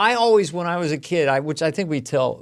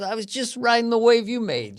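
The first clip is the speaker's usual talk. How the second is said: a light, higher put-on voice of the humble comic; humble comic quote; 9 semitones higher than usual. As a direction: lightly voice him shrugging off praise. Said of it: "He goes".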